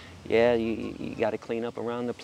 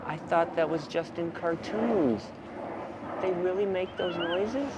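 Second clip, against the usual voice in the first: slow, higher-pitched wondering voice; naive amazement; 4 semitones higher, slower than usual. Retold or imitated. Visitor hearing real animal noises, slow naive wonder. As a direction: imitate someone slowly taking in a surprise.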